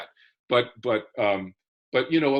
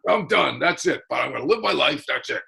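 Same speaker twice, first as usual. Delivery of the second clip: put-on dad's Brooklyn accent; 3 semitones higher than usual. Son mimics his dad's famous saying in a Brooklyn-Boston accent. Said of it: "And he talked like this".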